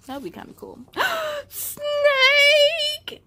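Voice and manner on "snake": high, louder alarmed cry; startled snake cry. Acted. Kids spotting a snake in the jungle, alarmed cry. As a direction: gasp then yell the warning.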